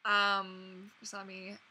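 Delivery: ordinary delivery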